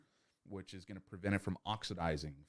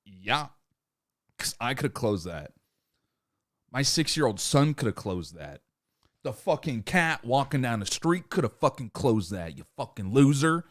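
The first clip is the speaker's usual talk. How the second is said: louder, building mock rant ending in an insult; scornful boss; 4 semitones higher, 9 dB louder than usual. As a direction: berate the employee, growing louder. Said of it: "And your boss is like".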